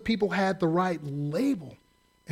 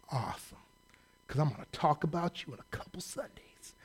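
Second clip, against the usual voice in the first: low, sly under-the-breath whisper; dry whispered aside; 4 semitones lower, 10 dB quieter than usual. Retold or imitated. Pastor privately mocking the prophet, whispered aside. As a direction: mutter the joke under your breath.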